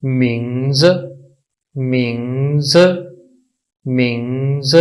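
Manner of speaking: मिंग्स drawn out, first part lifted, last part dropped; tone demo. Shown as a demonstration. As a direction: model मिंग्स slowly for learners. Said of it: "इसकी pronunciation कुछ यूं होगी".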